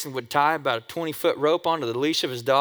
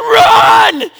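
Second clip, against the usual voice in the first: much higher and louder shout of run; shouted warning; 15 dB louder than usual. Retold or imitated. A kid warning his friends, loud panicked shout. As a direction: yell it like the street is about to blow. Kids fleeing the firework.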